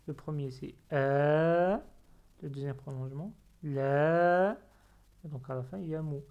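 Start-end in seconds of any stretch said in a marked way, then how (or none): 0.9-1.8 "a" as a long, held open vowel, louder; prolonged alif. Shown as a demonstration. Teacher showing the first prolongation, "a" held long. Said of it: "on prolonge le alif avec fatha". 3.6-4.6 "la" with a long held vowel, louder; prolonged lam. Shown as a demonstration. Teacher showing the second prolongation, "la" held long. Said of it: "on prolonge le lam avec fatha".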